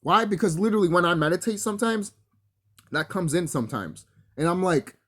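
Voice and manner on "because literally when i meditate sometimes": ordinary talk, nothing marked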